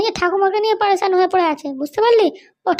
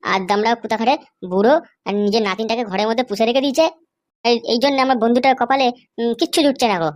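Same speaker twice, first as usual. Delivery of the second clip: low, mocking thug voice; young thug; 9 semitones lower than usual. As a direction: taunt the old man from deep in the chest. Thug insults the grandfather. (নিচু গলায় ঠাট্টা করা গুন্ডা)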